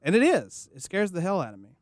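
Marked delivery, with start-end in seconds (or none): 0.0-0.7 high-pitched comic president voice; Dana Carvey as George Bush. Acted. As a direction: toss out a presidential parody catchphrase. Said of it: "as Dana Carvey, as George Bush would say".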